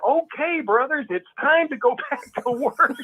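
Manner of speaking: high, drawn-out rallying call; mock elder's announcement. Leaders calling the brothers back to work, mock rallying announcement. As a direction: send up a leader summoning the flock, breaking into laughter. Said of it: "and they say".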